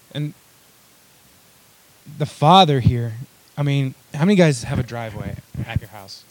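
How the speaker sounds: usual speaking voice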